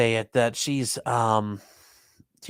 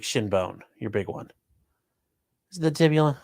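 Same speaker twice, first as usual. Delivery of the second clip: ordinary delivery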